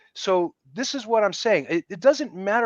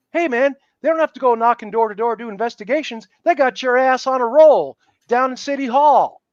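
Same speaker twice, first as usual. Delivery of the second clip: emphatic rising warning; 5 semitones higher, 6 dB louder than usual. Real, not acted. Warning about the registry of same-sex couples.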